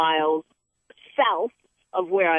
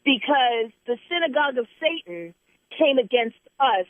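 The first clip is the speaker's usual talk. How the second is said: preaching; 6 semitones higher than usual. Real, not acted. Host preaching to a listener about reaping, high and intense.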